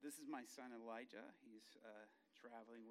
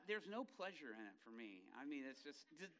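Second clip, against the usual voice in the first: ordinary talk, nothing marked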